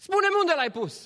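high, insistent demand; arguing Maria. Acted. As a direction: press the gardener for an answer. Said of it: "se certa acolo cu grădinarul".

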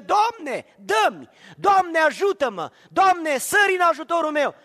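loud, high cries of the needy; desperate pleading to God. Acted. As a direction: beg the Lord for help at full voice. Voicing the crowds crying to God.

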